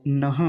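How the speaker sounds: नह ending in an audible h breath; sounded visarga. Shown as a demonstration. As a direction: let air out after न in नह. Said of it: "नह में पीछे विसर्ग लगा हुआ है". (नह में विसर्ग का स्पष्ट उच्चारण)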